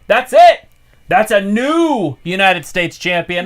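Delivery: loud, high title call; wrestling commentator. Real, not acted. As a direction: announce the new champ. Live call of title win.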